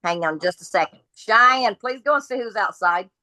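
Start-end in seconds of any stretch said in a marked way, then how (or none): 1.2-3.1 raised, higher call to someone in another room. Real, not acted. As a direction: call her name across the house. Calling to someone in house.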